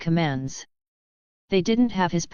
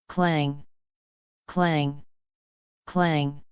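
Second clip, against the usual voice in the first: metal clang; 3 semitones lower, much slower than usual. A narrator's reading. Weapons clashing.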